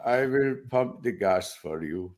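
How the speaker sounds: put-on slow, lightly done Indian accent; a gentle Indian shop-clerk accent. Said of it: "I will do the Indian accent".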